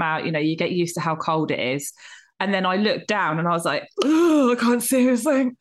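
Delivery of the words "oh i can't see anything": higher, louder mock panic; retold panic. Swimmer looking down into dark water, mock panicked. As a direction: act out a swimmer's alarmed cry.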